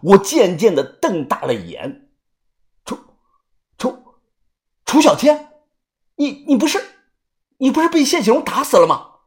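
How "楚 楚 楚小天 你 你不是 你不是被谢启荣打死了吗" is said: high-pitched, stunned disbelief; shocked stammer. Acted. Man recognising someone he believed dead, stammering in shock. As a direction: stammer the name, then rush up high in shock.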